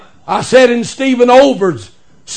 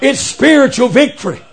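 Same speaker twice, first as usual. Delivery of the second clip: ordinary delivery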